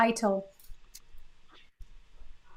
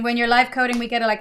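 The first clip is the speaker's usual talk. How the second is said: ordinary talk, nothing marked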